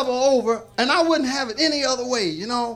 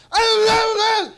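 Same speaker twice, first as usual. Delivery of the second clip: loud, high fake angry shout; pretend mad; 11 semitones higher, 7 dB louder, slower than usual. Showing the crowd how he used to get mad, faked angry shout. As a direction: ham up a mad yell. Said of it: "I pretend how to be mad now".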